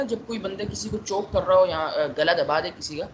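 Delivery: ordinary delivery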